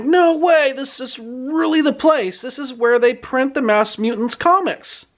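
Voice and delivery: mock excited discovery; jazzed. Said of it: "He's pretty jazzed".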